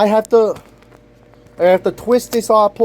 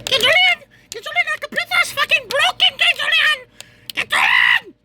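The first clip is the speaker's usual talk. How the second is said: frantic shouting; 13 semitones higher than usual. Real, not acted. Vlogger yelling while the laptop parts pop up, frantic shouting. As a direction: yell it like a desperate cheer.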